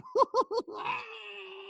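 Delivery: high evil laughter; villain laugh. Acted. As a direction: cackle like a villain.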